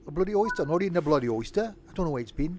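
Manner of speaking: hammed-up Brummie refusing oysters; mock Brummie accent. Joking to chat about Brummies and oysters, bad Brummie accent. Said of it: "I don't know what accent that was supposed to be".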